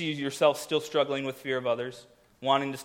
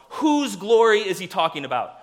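usual speaking voice

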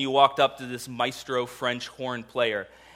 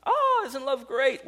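higher and slow, sugary mock delight; mock sweet; 10 semitones higher, slower than usual. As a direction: gush sweetly like a naive fan. Mocking naive listener.

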